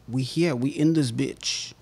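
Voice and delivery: slow, lightly voiced rapper swagger. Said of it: "I think I just sounded like [name]".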